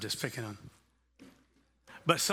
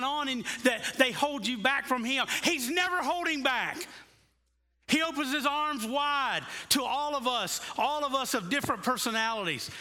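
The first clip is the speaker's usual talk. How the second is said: higher and louder, swelling preacher's cry; preaching at full force; 8 semitones higher, 3 dB louder than usual. Acted. Pastor urging the congregation that God opens his arms, full-force preaching. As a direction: preach it out, climbing with fervour to the congregation.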